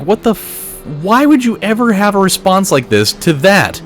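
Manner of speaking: high, disbelieving mock outrage; incredulous listener. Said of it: "gonna be like".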